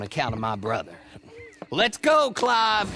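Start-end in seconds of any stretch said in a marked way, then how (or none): 1.7-2.9 calling out. Acted. Clay calls his brother to leave.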